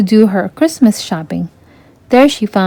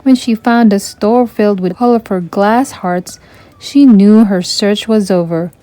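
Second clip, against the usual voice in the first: plain reading voice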